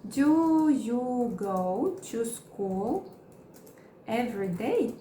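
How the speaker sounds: very slow, split into chunks; slow broken-up teaching read; slow model question. Shown as a demonstration. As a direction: say it slowly piece by piece. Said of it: "Eu vou colocar do".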